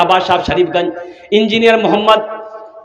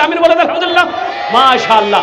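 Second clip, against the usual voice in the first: rousing call; 7 semitones higher, much faster than usual. Real, not acted. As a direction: lift the crowd to answer. Rousing the gathering.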